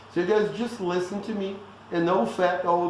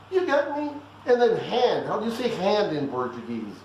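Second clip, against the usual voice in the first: ordinary talk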